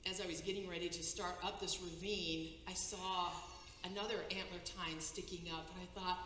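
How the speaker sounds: ordinary talk, nothing marked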